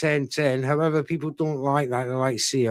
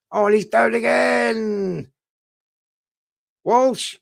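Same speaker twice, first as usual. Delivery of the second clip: excited call; 8 semitones higher, 4 dB louder, slower than usual. Real, not acted. Commentator sees a knockdown.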